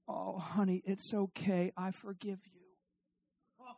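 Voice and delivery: soft, tender mock reassurance; soothing husband. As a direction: soothe gently, trailing off very quiet. Husband soothes crying wife in retelling.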